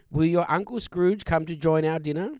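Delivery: ordinary talk, nothing marked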